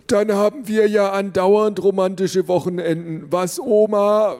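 a doddering grandfather teasing his wife; old grandpa voice. A narrator's reading. Grandpa jokes to grandma, hammed-up old voice.

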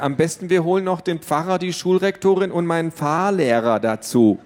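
high, mock-serious suggestion; teen mocking her parents; sarcastic. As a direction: pile it on sarcastically. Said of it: "meinte sie aber nicht ernst".

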